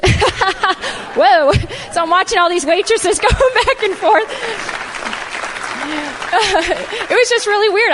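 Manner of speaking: high-pitched, laughing as she talks; words riding on real laughter. Real, not acted. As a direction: break up laughing mid-story. Laughing at being served there herself.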